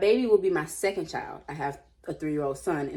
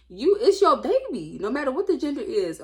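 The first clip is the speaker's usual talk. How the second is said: louder, higher scolding, slowed at first; 8 semitones higher, 8 dB louder than usual. Real, not acted. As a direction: scold her straight. Scolding Lena.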